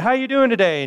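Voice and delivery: cheery greeter. Retold or imitated. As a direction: greet like an eager extrovert at the door. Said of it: "so they said".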